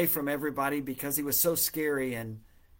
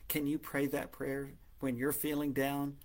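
normal talking voice

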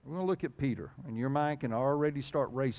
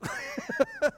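high burst of laughter. Real, not acted. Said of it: "that's the way I read that".

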